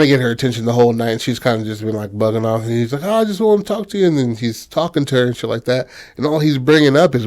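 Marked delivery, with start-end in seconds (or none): 3.0-4.1 high, quick wheedling plea; pleading suitor. Retold or imitated. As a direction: imitate an eager guy begging for attention. Suitor pesters her.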